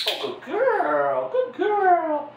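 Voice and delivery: high, slow cheerleader praise; schoolgirl voice. Real, not acted. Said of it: "my little thirteen-year-old cheerleader, you know, schoolgirl voice".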